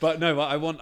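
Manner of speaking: words spilling out through a real laugh. Real, not acted. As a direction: keep laughing as you start the sentence. Laughing after joke about workers.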